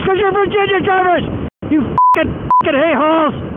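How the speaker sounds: high furious yell at drivers; angry yelling. Real, not acted. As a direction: shout it out in road rage. Ranting at other drivers.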